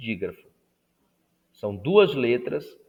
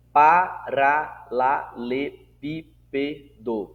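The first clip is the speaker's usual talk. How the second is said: very slow, each syllable set apart; 4 dB louder, much slower than usual. Shown as a demonstration. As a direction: count out PARALELEPÍPEDO beat by beat. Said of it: "Acima de".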